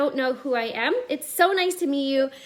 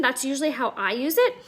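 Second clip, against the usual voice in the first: ordinary talk, nothing marked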